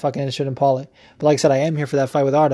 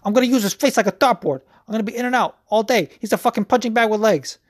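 higher, fast cocky trash talk; mocking Paulie; 6 semitones higher, faster than usual. Podcaster mocks Paulie's MMA Hour boasts in a higher, fast cocky voice. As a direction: rattle it off like a boastful fighter. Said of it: "and he was like".

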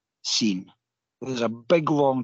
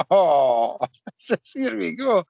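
laughing disbelief; 5 semitones higher, 3 dB louder, much slower than usual. Retold or imitated. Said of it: "And I'm going".

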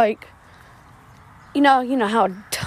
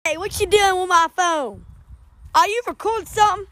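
Random Fact Joe voice; 8 semitones higher than usual. Acted. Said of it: "And I'm Random Fact Joe".

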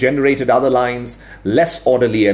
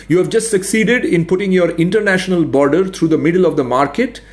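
higher, dryly mocking; sarcastic administrator; 6 semitones higher than usual. A narrator's reading. Veteran administrator ridiculing the expert's border, sarcastic. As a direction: lay out the absurdities with polite scorn.

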